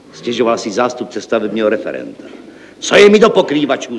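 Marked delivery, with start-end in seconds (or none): 2.8-4.0 high, loud outburst; exclaiming. Acted. Director dismisses talk of roofers, exclaiming loudly. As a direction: throw the question out impatiently. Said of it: "zvolal podnikový ředitel".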